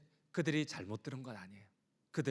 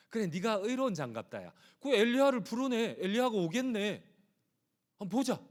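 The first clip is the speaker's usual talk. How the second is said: higher, sneering taunt; mocking jeer, pitched up high; scornful mockery; 8 semitones higher, 4 dB louder than usual. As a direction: jeer at him and dare him to be saved. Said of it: "똑같이 이렇게 얘기를 해요".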